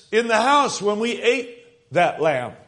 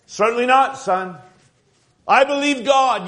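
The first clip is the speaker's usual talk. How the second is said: louder, higher, ringing with faith; confident father; 4 semitones higher, 4 dB louder than usual. Acted. Father reassuring his son during the plague, bold confident declaration. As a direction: declare it like a proud believing dad.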